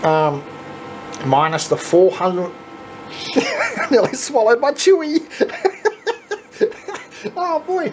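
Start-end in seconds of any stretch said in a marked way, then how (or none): 3.3-7.9 high-pitched words squeezed out through real laughter; helpless laughing mess. Real, not acted. Nearly swallowed gum while counting cash, laughing helplessly. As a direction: laugh so hard the voice climbs. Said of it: "I'm a mess right now".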